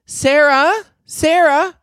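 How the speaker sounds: high-pitched call across the office; mimicked high yell; imitating boss yelling. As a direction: holler a name like the boss summoning staff. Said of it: "I hear her yell from the office".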